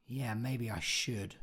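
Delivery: slow, soft thinking aloud; tentative decision. Acted. Character coming to a decision in a monologue, quiet and slow. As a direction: come to the decision quietly and slowly. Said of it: "you come to a decision".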